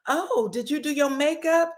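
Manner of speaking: high, lightly put-on Baltimore accent, teasing; a hint of Baltimore in a mocking imitation. Teasing Kay with a light Baltimore accent. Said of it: "trying to do the Baltimore accent".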